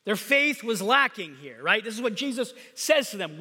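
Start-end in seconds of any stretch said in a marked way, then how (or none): none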